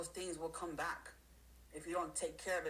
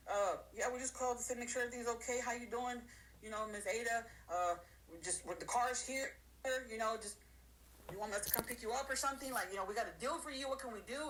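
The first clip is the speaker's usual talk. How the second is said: higher, overly sweet salesman voice; sugary salesman; 5 semitones higher than usual. Retold or imitated. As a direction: lay on the fake friendliness to lure them back. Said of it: "they're gonna reach out and be like".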